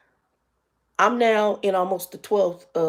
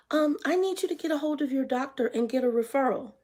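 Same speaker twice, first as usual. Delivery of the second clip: mimicking Peach; 6 semitones higher, 4 dB quieter than usual.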